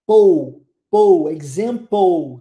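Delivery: "Pou, pou, example", ending in a loud, drawn-out "pou"; "example" slowed, its LE sounded like "pou"; final LE as "ou". Shown as a demonstration. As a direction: punch out "pou", then say "example" slowly as "ex-zem-pou". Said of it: "L E, som de ou".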